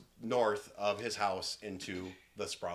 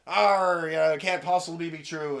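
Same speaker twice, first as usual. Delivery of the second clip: loud growled "arr" pirate talk; raised, blustering pirate voice; pirate captain; 5 semitones higher, 9 dB louder than usual. Acted. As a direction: answer like a hammed-up sea captain. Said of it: "so the captain looks over".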